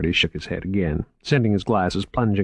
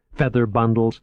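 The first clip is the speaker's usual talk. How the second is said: usual speaking voice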